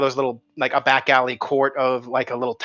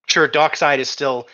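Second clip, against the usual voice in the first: usual speaking voice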